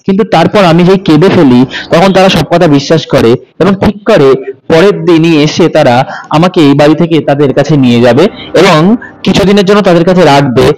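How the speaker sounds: ordinary delivery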